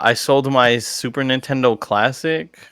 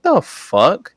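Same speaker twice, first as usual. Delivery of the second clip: incredulous; 7 semitones higher than usual. Retold or imitated. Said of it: "I'm like".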